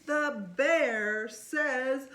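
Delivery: put-on lively read-aloud to a child; storybook reading. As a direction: read it like bedtime story time. Slow building suspense.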